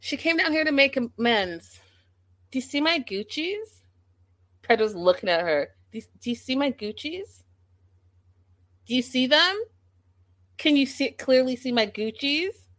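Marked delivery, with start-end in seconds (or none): 2.5-12.7 mock show-off. Mocking Lydia showing off glasses.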